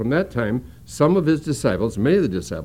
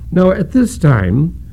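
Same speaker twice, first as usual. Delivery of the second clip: slow, loud reading of the verse; scripture reading; 5 semitones higher, 8 dB louder, slower than usual. Acted. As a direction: read it out to the congregation, louder.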